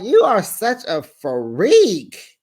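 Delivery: mock-scolding the wife, slow and loud. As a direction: stretch every word out, loud and disgusted. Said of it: "I'm like".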